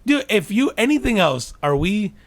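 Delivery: loud high indignant rant. Real, not acted. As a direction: rant in disbelief.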